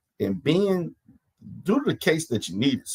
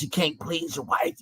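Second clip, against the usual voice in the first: normal talking voice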